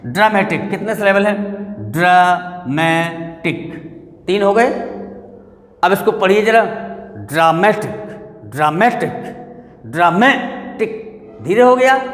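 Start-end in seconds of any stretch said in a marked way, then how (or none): 1.9-3.7 "dramatic" slowly, as draw, मे, टिक; syllables separated. Shown as a demonstration. Teacher counting syllables, "dramatic" slowly split in three. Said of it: "अलग-अलग कर लीजिए". 7.2-11.2 "dramatic" with a strong, stretched मे; stress on MA. Shown as a demonstration. Teacher asking where stress falls, "dramatic" stressed on मे. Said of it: "मैं पर पड़ा".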